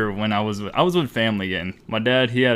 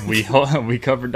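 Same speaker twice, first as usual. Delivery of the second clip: ordinary talk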